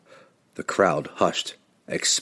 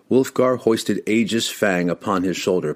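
usual speaking voice

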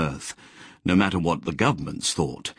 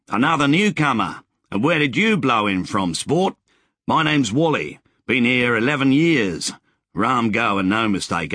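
higher, chatty and cheerful Wally voice; cheerful old settler; 3 semitones higher than usual. Acted. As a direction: play the friendly grizzled rider greeting a stranger. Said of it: "said cheerfully".

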